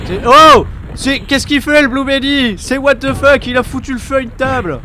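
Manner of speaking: high, loud disbelieving outburst; shocked shout. Real, not acted. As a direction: yell in total disbelief. Shocked at table set on fire.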